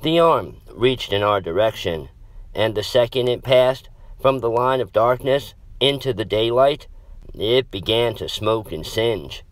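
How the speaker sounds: usual speaking voice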